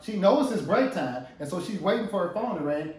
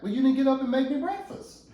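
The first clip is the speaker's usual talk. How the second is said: high-pitched petulant complaint; acted husband's protest; aggrieved husband; 9 semitones higher than usual. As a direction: lay out the grievance, voice pitched up.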